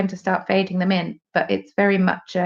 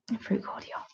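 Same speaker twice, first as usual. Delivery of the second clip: whispered; 15 dB quieter than usual. Shown as a demonstration. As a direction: repeat it in a soft whisper. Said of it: "It's a fruit cordial".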